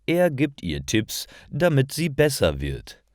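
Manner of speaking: usual speaking voice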